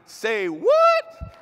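sudden high, loud burst of disbelief; a real startled double take; surprised say what. Real, not acted. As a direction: blurt it out, caught off guard. Presenter baffled by the team's hometown.

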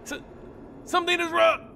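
terror rising as he breaks apart; panicked cry. A narrator's reading. Burning vampire crying to the room, climbing panic.

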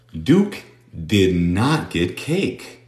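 Duke did not get cake, word by word, very slowly; very slow model sentence. Shown as a demonstration. Teacher reads the practice sentence word by word, very slowly. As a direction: spell it out slowly, one word at a time. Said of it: "teacher models sentence".

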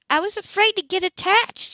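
high, loud snap; bursting out defensively; snapped. A narrator's reading. Woman answering his accusation about the boy, snapping. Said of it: "she snapped out".

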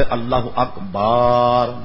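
loud wrong takbir. Shown as a demonstration. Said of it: "یہ بھی غلط".

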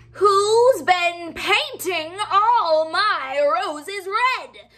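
high, loud, slow furious queen; Queen of Hearts raging at the gardeners; yelling Queen of Hearts. Acted. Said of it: "yelled the queen".

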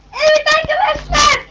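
high, loud host callout. Real, not acted. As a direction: call it out to the room.

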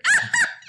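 very high, loud burst of laughter; helpless laughter. A narrator's reading. Boys teasing Henry over garbage, helpless laughter. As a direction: let a shrieking laugh burst out. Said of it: "doubled up with laughter".

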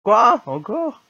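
high, loud disbelieving yelp; outraged surprise. Real, not acted. Player shocked to lose progress again, loud disbelief. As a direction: cry out in disbelief. Game undoes his work again.

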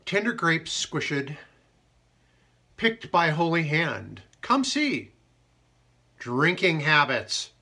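slow, loud dramatic poem reading; slow recital, every word weighed; haiku reading. Acted. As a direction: read each line like a stage poem.

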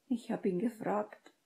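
timid little reply; meek, subdued. A narrator's reading. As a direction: admit it sheepishly. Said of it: "erwiderte Hermine kleinlaut".

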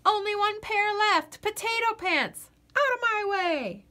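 high determined battle cry; determined shout. Acted. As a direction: yell as you barge in. Potato charges for last pair.

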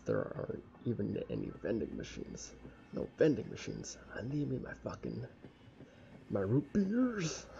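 ordinary delivery

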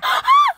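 high loud gasp; shocked gasp. Real, not acted. Watching an anime, gasping at a shocking scene.

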